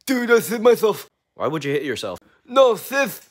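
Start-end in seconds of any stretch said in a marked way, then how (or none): 0.0-1.1 lisping S sounds. Acted. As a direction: say "myself" with a heavy lisp. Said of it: "Meet my friend with a lisp".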